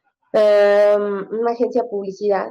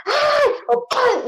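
high-pitched "ay" and imitated smack; mock exasperated cry; much slower than usual. Mother at her limit, mock high cry and smack. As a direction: act out a squeal of exasperation, then the slap noise. Acting out losing her temper.

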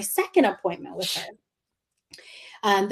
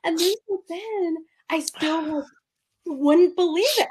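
tearful; 8 semitones higher than usual. Real, not acted. Still tearful after crying.

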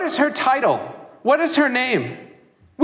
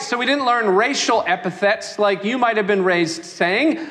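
ordinary delivery

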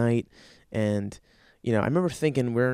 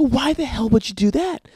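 high, exasperated question; exasperated disbelief; 9 semitones higher, 4 dB louder than usual. Acted. Boyfriend learns girlfriend told the police, exasperated question. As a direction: demand an explanation in disbelief.

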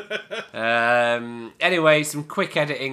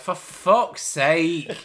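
drawn-out, higher exasperated groan; frustrated; 5 semitones higher, 5 dB louder, slower than usual. Retold or imitated. Host imitates Simon Pegg's acting, frustrated and insistent. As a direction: voice someone utterly fed up with it dragging on.

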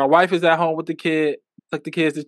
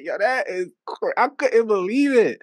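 incredulous; 7 semitones higher than usual. Retold or imitated. Retold disbelief.

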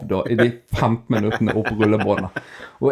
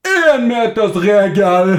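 loud, high, slow shouted rule; angry shout; 10 semitones higher, 6 dB louder, much slower than usual. Retold or imitated. Old man on the walkway shouting after the speaker. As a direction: bark the distance rule at the passer-by. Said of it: "så roper han til meg".

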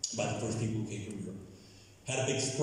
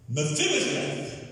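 raised-pitch exclaimed greeting by name; acted cry of recognition; calling out; 5 semitones higher, 4 dB louder, slower than usual. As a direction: call the name out as a king greeting someone. King calls out the name, acted.

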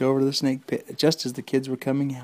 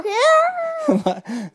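shrieking laugh; 7 dB louder than usual. Acted. Mock scream laugh.

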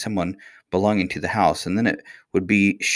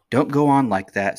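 sharp, raised-pitch scolding; mildly angry reproof; almost fierce; 6 semitones higher, 4 dB louder than usual. A narrator's reading. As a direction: rebuke the boy with restrained fierceness. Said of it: "said the nurse to the boy almost fiercely".

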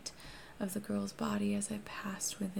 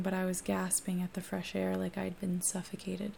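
plain reading voice

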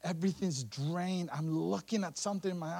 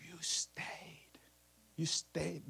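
soft whisper; 5 dB quieter, much slower than usual. Acted. Jesus tenderly honors Mary.

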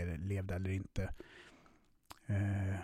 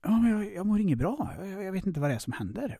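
pleading panic; 8 semitones higher, 4 dB louder than usual. Retold or imitated. Answering ex while panicking.

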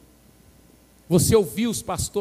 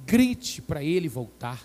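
usual speaking voice

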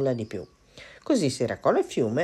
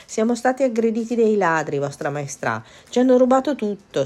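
louder, higher put-on distress for the king; cat faking outrage, pitched up and loud; playacting victim; 8 semitones higher, 5 dB louder than usual. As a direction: sell the robbery story like a skilled liar. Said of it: "recitando alla perfezione".

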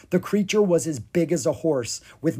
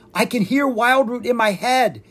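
higher, louder wonder; amazed; 10 semitones higher, 6 dB louder than usual. Acted. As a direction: marvel at the voice inside. Oscar amazed.

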